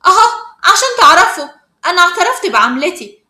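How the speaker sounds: high, loud announcement; defiant confession. Acted. Acted confrontation, confessing before others.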